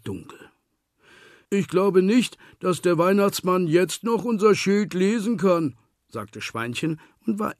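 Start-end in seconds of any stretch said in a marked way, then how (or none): 1.5-5.8 piglet voice. A narrator's reading. Relieved piglet speaks.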